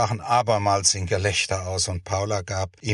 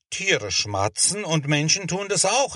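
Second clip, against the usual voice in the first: insistent, with SCHMATZEN and AUCH pressed in a raised pitch; higher, emphatic stress up to a firm "auch"; 6 semitones higher than usual. A narrator's reading. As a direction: argue the point, leaning on "auch". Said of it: "erklärte Bernhard mit Nachdruck".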